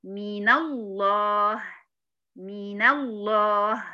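"من الله" drawn out very slowly, the nun opened to "mina llah"; slow Quranic "من الله" with fatha on the nun; correct recitation. Shown as a demonstration. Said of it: "هقول كده".

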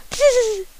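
very high piercing tss; shrill tss call. A narrator's reading. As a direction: call into the dark for the dog.

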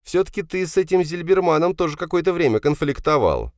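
put-on high-pitched resentful young man's role; Павел, задиристый сын. (высокий голос задиристого сына)

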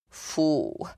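soft, sighing revulsion; quiet exhale of distaste; exhaled disgust. A narrator's reading. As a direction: breathe it out in disgust. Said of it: "выдохнул Григорий".

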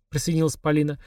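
plain reading voice

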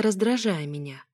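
plain reading voice

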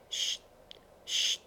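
soft breathy shush; a dying man's faint shush; hushing whisper. A narrator's reading. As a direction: hush him gently, barely a sound.

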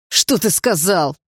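loud, slow, furious demand; enraged. A narrator's reading. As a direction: breathe out the question in fury. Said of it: "разъярённо выдохнул Дима". (разъярённо, на выдохе)

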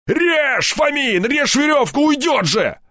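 high, urgent yelling; frantic shouted order. A narrator's reading. Степаныч orders the rope cut, shouting frantically. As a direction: scream orders in a panic.

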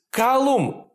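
calls up the stairs, high; calling out. A narrator's reading. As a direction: shout a name upward. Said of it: "позвала она".

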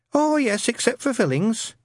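high, polite, timid lad; nervous young man. Acted. Newton asking Shadwell about the ad, timid and high. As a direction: ask shyly, voice up.

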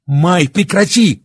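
snapping angrily. Acted. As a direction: stop him with an indignant outburst. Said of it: "взвилась Оксана".

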